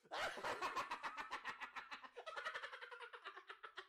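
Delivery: long quiet high laugh; soft laughter. Real, not acted. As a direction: giggle that trails away. Watching the clip.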